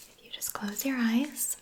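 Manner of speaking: ordinary talk, nothing marked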